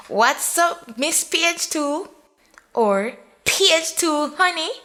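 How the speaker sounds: high, lilting teasing calls; teasing friends. A narrator's reading. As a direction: sing out the nickname.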